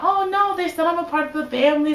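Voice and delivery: high, fast, hopeful gush; rushed, high-pitched self-reassurance; mock naive optimism. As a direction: act out your past rosy assumption. Retells her naive hopeful earlier thought.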